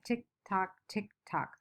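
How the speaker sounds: whispery clock imitation; clock sound. Shown as a demonstration. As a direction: tick tock like a quiet clock. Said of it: "the sound that the clock is making".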